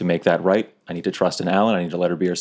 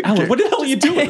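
high, fast holler; alarmed call; faster than usual. Acted. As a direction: yell across at a friend in disbelief. Calling out to Alan in panic.

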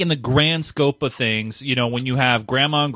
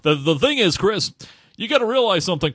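radio voice; 5 semitones higher than usual. Acted. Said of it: "doing that voice the whole time".

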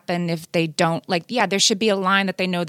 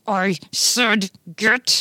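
through clenched teeth; much slower than usual. Retold or imitated. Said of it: "If she got to the clenched teeth".

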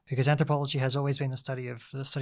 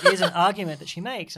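laughing; 4 semitones higher, 10 dB louder than usual. Real, not acted. Laughing at her own mother joke.

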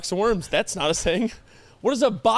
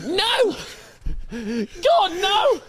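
pet protesting; 11 semitones higher, much slower than usual. Pretend. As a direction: recoil like a pet being licked. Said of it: "I'd be like".

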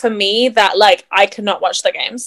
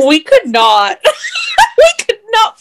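laughing; 11 semitones higher, 3 dB louder than usual. Real, not acted. Laughing disagreement with co-host.

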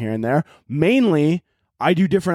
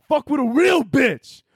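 much higher, slower, strutting bro voice; hyped-up bro boast; 11 semitones higher, slower than usual. Retold or imitated. Bragging to himself after the gym compliment, cocky high bro boast.

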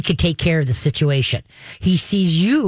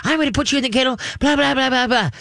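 a higher-pitched, lightly hammed-up lecturing voice; mock nagging woman; 8 semitones higher, faster than usual. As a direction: poke fun at a long-winded scolding. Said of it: "Women give paragraphs".

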